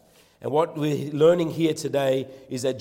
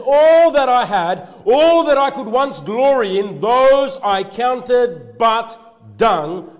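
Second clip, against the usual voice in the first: high, loud, slow and drawn-out proclamation; preaching at full force; 8 semitones higher, 11 dB louder, much slower than usual. Acted. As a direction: hammer each word home, slowly. Preached climax of Paul's words.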